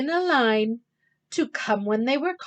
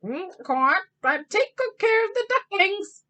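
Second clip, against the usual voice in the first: Mr. Mallard voice; 9 semitones higher than usual. Acted. Mr. Mallard speaks to wife.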